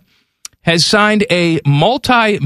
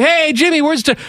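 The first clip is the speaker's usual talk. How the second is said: put-on high-pitched kid demanding his winnings; retold kid calling out; 10 semitones higher than usual. Kid to Jimmy after making the shot, mock demanding. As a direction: call out like an eager kid owed money. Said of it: "made the shot and be like".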